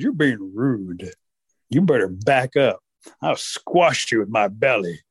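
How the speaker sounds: slow, low bear warning; threatening bear. Acted. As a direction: lay down a heavy, unhurried threat. Bear warns Rabbit.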